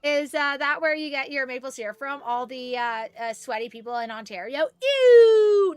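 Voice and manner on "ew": disgusted ew. Real, not acted. Disgust at sweaty Ontario syrup.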